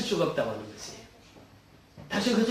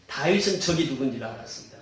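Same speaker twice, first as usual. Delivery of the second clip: ordinary talk, nothing marked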